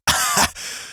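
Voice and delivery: short scornful laugh; harsh laugh. A narrator's reading. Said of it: "He let out a harsh laugh".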